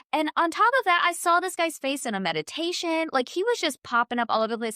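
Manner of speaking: ordinary delivery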